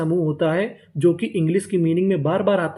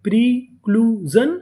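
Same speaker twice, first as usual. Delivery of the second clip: प्रीक्लूजन drawn out as pre, clue, जन; syllable by syllable; 3 semitones higher, slower than usual. Shown as a demonstration. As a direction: teach प्रीक्लूजन slowly, one part at a time. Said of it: "तो पूरी meaning". (प्रीक्लूजन को तीन हिस्सों में धीरे-धीरे)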